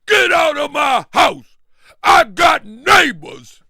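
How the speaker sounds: high, loud, slow shout; mock angry yell. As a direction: drag out the eviction yell. Repeats host's yell.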